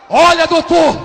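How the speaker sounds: high, loud call to the doctor; loud protest. Acted. Nurse refusing to meddle with believers, loud protest. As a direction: cry out in alarmed refusal.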